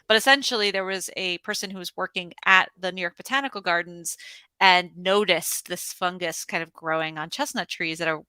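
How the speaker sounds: usual speaking voice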